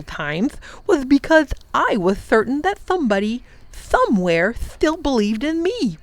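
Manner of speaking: a lonely king telling how faith kept him alive; Wangdoodle's tale. Acted. The Wangdoodle tells the professor why he survived, earnest.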